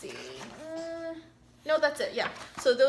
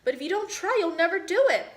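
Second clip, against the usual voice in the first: urging parent; 6 semitones higher, 4 dB louder, faster than usual. Acted.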